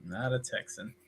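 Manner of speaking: soft, laughing repeat of the verdict. Real, not acted. Cohost repeats his verdict on Machete, laughing. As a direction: chuckle it out softly.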